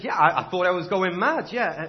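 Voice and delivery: a touch of relieved laughter; relieved admission. Acted. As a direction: blurt out relief at not being mad. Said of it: "He said".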